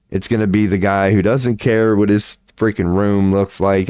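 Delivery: ordinary delivery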